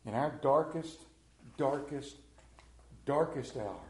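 darkest repeated three times, each one leaned on; stress on darkest. Acted. Preacher to congregation, stressing darkest. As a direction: hammer darkest again and again. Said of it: "repetition for emphasis".

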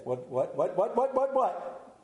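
mock panic. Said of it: "I'm scared to death".

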